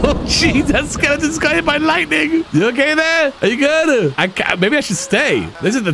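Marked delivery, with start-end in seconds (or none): 0.0-4.1 higher, startled concern; hammed-up alarmed sim voice; mock shock. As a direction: gasp at the lightning strike. Skeleton struck by lightning.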